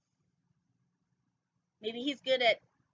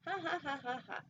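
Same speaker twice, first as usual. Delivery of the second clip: sneaky villain laugh; 4 semitones higher, 4 dB quieter than usual. Acted. Sneaky shark gloats.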